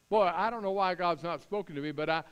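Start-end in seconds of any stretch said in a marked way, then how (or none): none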